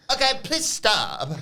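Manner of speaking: very high, slow mock whine; squealed diva impression; Tiffany Stratton. Host mimics wrestler telling rival off, exaggerated squeal. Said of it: "And Tiff says".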